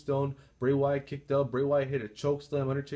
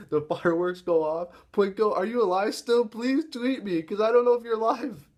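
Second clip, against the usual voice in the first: higher and louder, laughing; excited laughing shout; 8 semitones higher, 6 dB louder than usual. Real, not acted. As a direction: call out to a friend in the hype. Shouting to a friend, still buzzing.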